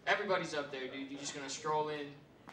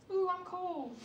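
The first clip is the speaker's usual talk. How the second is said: high, whiny mock complaint; high mocking whine about the cold; mocking girlfriend voice; 12 semitones higher, 3 dB quieter, slower than usual. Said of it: "she has a nerve to say to me".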